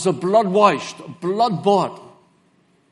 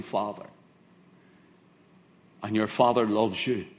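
tender reassurance; 6 semitones lower, 4 dB quieter than usual. Real, not acted.

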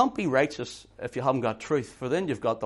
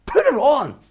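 loud, much higher rebuke; stern shouted order; 11 semitones higher, 9 dB louder than usual. Acted. The Lord stopping a careless believer, a loud stern order. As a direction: snap it out as a command. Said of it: "He's saying".

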